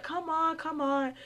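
put-on high, pleading kid urging; eager girls. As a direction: coax her in, high and eager. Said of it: "the girls were like".

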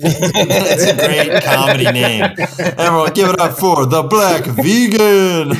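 laughing through a mock club intro; laughing mock announcer. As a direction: hype the act while cracking up. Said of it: "laughing intro".

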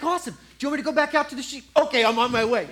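fast, high, chirpy rush; eager kid. Acted. David rushes back to his sheep, fast and eager. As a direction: bubble over like an eager boy.

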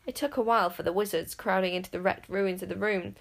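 plain reading voice

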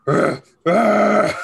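imitated monster growls, belted out; scary monster noises. Dad imitating noises heard walking into the house, scary monster roar. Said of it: "screaming and making scary noises".

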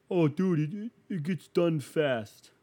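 loud, high, stammering mock excuse. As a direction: mimic a dim defender making excuses. Mocks riding mower owners' excuse.

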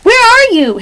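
high, searching call; shouting. A narrator's reading. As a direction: call out for someone out of sight. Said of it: "shouted Louise".

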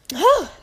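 short, high puff of breath; out-of-breath sigh. Real, not acted. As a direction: catch your breath with a quick audible sigh. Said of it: "I'll be out of breath".

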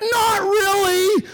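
high, slow wailing mock reply; stretched high-pitched mock whine; mock pessimist. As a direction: draw it out high like a helpless bystander. Mocks useless pessimist answering questions.

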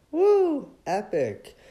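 sudden high whoop; sarcastic little celebration; mock cheer.

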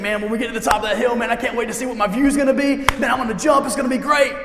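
high, loud, fast rush of anticipation; boyish excitement. Acted. Kid racing to the hilltop to jump, mounting excitement. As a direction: build to a thrilled shout. Voices excited boy at the hill.

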